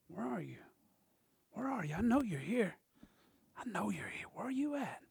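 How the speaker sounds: very soft, near-whispered calling; hushed searching. Retold or imitated. Preacher acting Jesus searching the crowd, hushed searching. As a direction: softly coax someone out of hiding.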